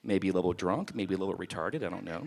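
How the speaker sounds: low quiet aside; muttered aside. Real, not acted. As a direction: drop it low, under your breath. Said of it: "aside dropping voice low".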